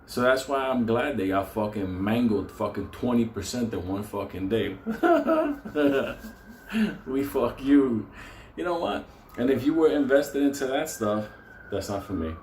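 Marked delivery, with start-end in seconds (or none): 4.8-7.0 shrill cackle of glee at their losses; high gloating laugh. Real, not acted. Laughing hard at investors getting mangled in one day. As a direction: laugh it up high, almost crying. Gloating over a crashing stock.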